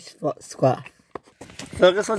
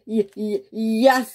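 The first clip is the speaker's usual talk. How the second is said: stammer; 6 semitones higher, much slower than usual. Acted. Stammering nervous yes to boss.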